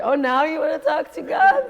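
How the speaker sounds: loud, high-pitched taunt; mocking outburst, pitched well up; nagging inner voice. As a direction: jeer at yourself like a maddening inner voice. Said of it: "That voice, you know, is driving me crazy".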